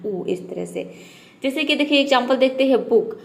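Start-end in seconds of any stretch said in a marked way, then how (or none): 0.1-0.2 ऊ said short and light; a soft, short ऊ; just a quick, light ऊ. Shown as a demonstration. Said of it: "हल्का ऊ बोलना है".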